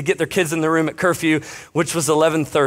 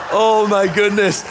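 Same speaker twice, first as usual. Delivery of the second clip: laughing; 4 semitones higher, 3 dB louder, slower than usual. Real, not acted. Laughing at own story.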